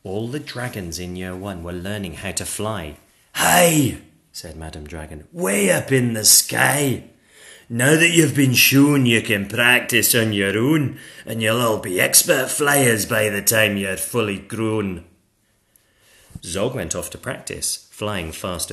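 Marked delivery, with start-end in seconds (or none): 3.3-4.0 loud, higher teacher's call; dragon schoolmistress. Acted. Dragon teacher calling to pupils. 5.3-15.0 loud, commanding dragon teacher; dragon schoolmistress. Acted. Madam Dragon teaches flying.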